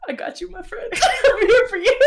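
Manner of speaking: high, giggly voice through laughter; laughing reassurance. Acted. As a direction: crack up while offering support. Player laughs through supportive line.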